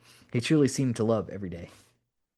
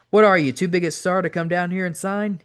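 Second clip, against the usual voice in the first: raised-pitch, half-yelled challenge; mild indignant yell down the phone; 7 semitones higher, 4 dB louder than usual. A narrator's reading. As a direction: needle him with a raised voice. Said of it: "he'd yell into the phone".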